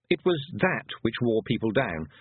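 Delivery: ordinary delivery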